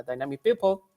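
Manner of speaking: normal talking voice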